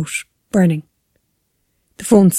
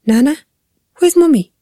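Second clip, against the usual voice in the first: put-on high-pitched little child's voice; raised, plaintive child voice; Charlie; 8 semitones higher than usual. A narrator's reading. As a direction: ask for Mummy like a small child. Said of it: "Charlie's voice".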